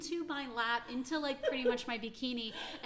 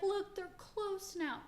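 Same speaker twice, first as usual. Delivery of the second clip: higher, slow sweet insistence; Angie; 8 semitones higher, slower than usual. Retold or imitated. Angie insisting the two are close now, higher and slow. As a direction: sell the friendship gently. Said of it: "Angie was like".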